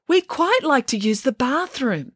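high, slow polite call; called through the door. A narrator's reading. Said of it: "Melanie called through the door".

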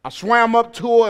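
normal talking voice

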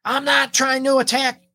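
high-pitched acted retort; mock defensive protest.

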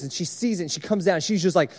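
usual speaking voice

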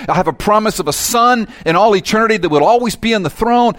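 put-on guilty old king in joyful thanks; old king praising. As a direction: exult as the forgiven old king. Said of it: "this old king saying".

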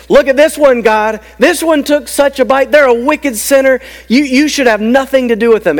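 high, forceful tattletale accusation; accusing Satan. Acted. Sly snake turning to accuse the sinner before God, accusing. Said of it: "like a sly snake and he says".

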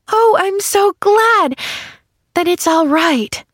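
high, drawn-out gladness; sighing little girl. A narrator's reading. Little girl glad it's all right, high happy sigh. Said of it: "sighed the little girl".

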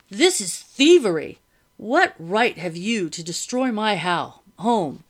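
loud, indignant householder; outraged protest. A narrator's reading. Master protesting the wrecking of his home, outraged. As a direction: protest loudly with heavy stresses.

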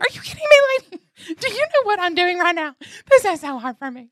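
very high, loud indignant rant; exasperated outburst. Retold or imitated. Praying woman's inner protest at the girl, high exasperated shriek. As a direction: squeal it in mock outrage. Acted outraged thought at the girl.

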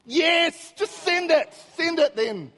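high, loud burst of frustration; re-enacted shout, at wits' end; exasperated yell. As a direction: lose your temper at the phone. Loses temper at Siri.

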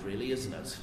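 usual speaking voice

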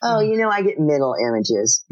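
ordinary talk, nothing marked

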